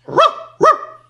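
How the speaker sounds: two short loud barks; dog barks. A narrator's reading. Dog agrees with the duck, barking sharply. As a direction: bark twice like a dog. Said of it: "Cane annuì".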